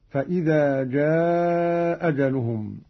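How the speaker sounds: جاء أجلهم with one hamza and a long drawn-out jaaa; dropped hamza, lengthened. Shown as a demonstration. Teacher showing madd, جاء held long before أجلهم, one hamza. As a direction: drop the hamza of جاء and stretch its vowel. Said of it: "مع القصر والمد هكذا".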